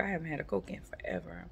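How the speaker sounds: quick whispered aside; breathy secret aside; whispering. Real, not acted. Whispered aside while eating.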